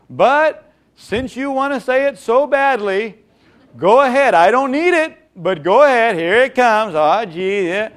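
hammed-up arrogant taunt; contemptuous dare. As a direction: sneer that you don't need it. Contemptuous inner voice.

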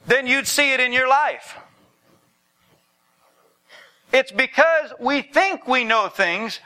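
high-pitched, forceful preaching cry. Real, not acted.

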